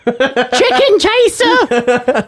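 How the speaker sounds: high, very slow sing-song taunt; squeaky taunt. Acted. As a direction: drag it out high and silly. Silly taunt voice.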